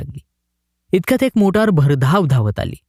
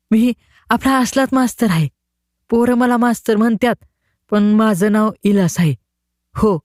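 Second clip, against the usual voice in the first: put-on higher boy's voice, rattling it off without pausing; all in one breath; 7 semitones higher than usual. A narrator's reading. The boy explains himself to the collector, all in one breath. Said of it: "एकाच दमामध्ये सारं सांगितलं".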